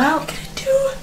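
high, soft, tearful whine; despairing whimper. Acted. Mother adrift without food, despairing high whimper. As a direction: whimper helplessly.

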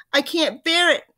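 high, loud storybook wail; distressed cry. A narrator's reading. Missus Twit hearing what the shrinks do, crying out. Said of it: "cried Missus Twit".

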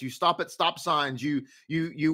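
ordinary delivery

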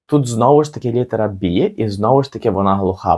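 ordinary delivery

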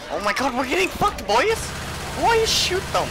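high, loud yelling at the team; panicked yell. Real, not acted. To his teammates while overrun, panicked high shout. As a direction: yell for help, rising into a shriek.

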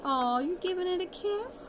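usual speaking voice

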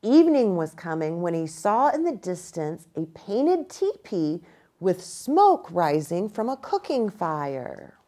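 usual speaking voice

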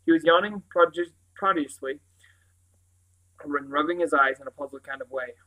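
ordinary delivery, nothing marked

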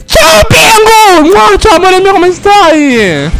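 high-pitched, affectionate squeals; gushing greeting. Real, not acted. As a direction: greet a favourite viewer with squealed delight. (saluto acuto e sdolcinato)

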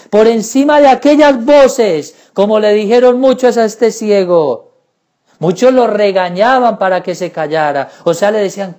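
usual speaking voice

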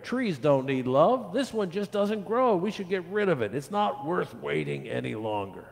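high, emphatic, impatient gardener; declaring. A narrator's reading. Gardener overrules the children, emphatic and impatient. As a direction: declare it high, then settle towards the end. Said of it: "the gardener declared".

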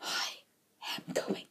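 very soft, broken-up whisper; despairing whisper. Acted. Director despairs over wasted money.

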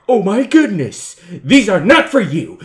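loud, slow, emphatic protest; alarmed warning shout. Pretend. Player guarding the fries from a giant, alarmed warning shout. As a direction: shout it slowly, punching each word. Warns huge figure off the fries.

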